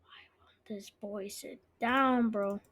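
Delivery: unvoiced exasperated whisper. Real, not acted. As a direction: hiss it under your breath. Frustrated aside about the player.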